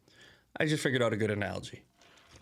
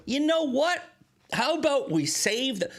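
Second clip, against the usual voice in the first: fed-up counterproposal; 11 semitones higher than usual. Acted. Acts out fed-up customer's decision.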